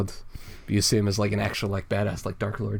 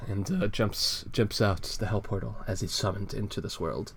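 ordinary talk, nothing marked

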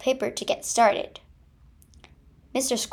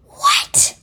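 very high, loud shrieked "What?"; panicked scream; 7 dB louder than usual. Acted. Squirrel shrieks "What?" in panic at the fight. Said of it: "panicked Mister Squirrel".

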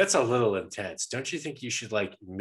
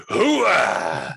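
loud, rising army hooah; loud gung-ho soldier; 6 semitones higher, 10 dB louder than usual. Retold or imitated. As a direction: bark it like a fired-up colonel. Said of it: "Al Pacino probably has something to say".

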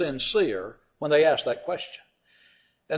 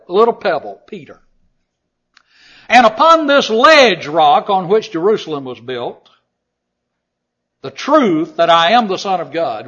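full-force preaching; 6 semitones higher, 10 dB louder than usual. Acted. Preacher proclaims Peter's confession to the congregation, shouting. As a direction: declare it like Peter's great confession.

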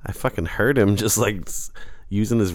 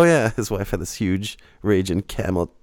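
normal talking voice

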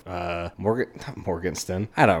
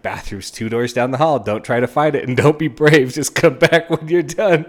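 bossy instructions building; 5 semitones higher, 7 dB louder than usual. Retold or imitated. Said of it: "she's like".

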